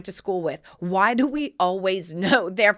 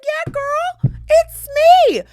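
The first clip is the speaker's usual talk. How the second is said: much higher, loud, squealed greeting; squealing girlfriend hello; 11 dB louder, much slower than usual. Retold or imitated. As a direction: squeal it to an old friend. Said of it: "And I said".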